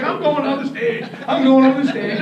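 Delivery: imitated giddy boasting; super happy. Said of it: "he's super happy".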